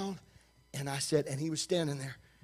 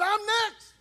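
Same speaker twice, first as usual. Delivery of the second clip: eager visitor; 12 semitones higher than usual. Retold or imitated. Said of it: "He said".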